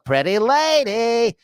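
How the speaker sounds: high, drawn-out dork voice; Jerry Lewis. Acted. Recalling the Nutty Professor character, high goofy dork voice. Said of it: "total dork Jerry Lewis as that guy".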